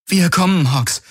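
high-pitched urgent reply; urgent call. Acted. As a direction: call to an injured comrade, pitch up. Kirai ruft dem Verletzten zu. (dringend und hoch zugerufen)

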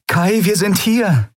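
high, slow call across the garden. Acted. Woman calls to Kai across the garden.

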